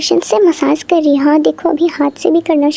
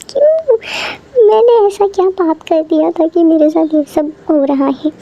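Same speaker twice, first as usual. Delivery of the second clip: mock wailing complaint; 2 semitones higher than usual. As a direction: wail it like a hammed-up victim. Mock complaint about the bad makeover. (नाटकीय रोनी शिकायत)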